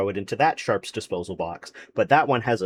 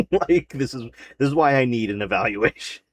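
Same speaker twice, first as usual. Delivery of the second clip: laughing; 3 semitones higher than usual. Real, not acted. Laughing at own story.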